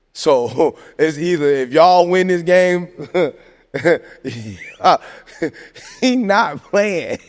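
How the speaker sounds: laughing through the words; slow, laugh-choked punchline; breaking into laughter. Real, not acted. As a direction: crack up midway, drag out the punchline. Cracking up at his own joke.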